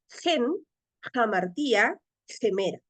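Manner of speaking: "Gen Jamartía Gemera" with each word opening on a Spanish jota; rough breathing. Shown as a demonstration. Greek teacher reading the second line, "Gen Jamartía Gemera" with rough breathings. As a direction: start every word of "Gen Jamartía Gemera" with an audible j sound. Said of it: "pronunciar como si fuera una".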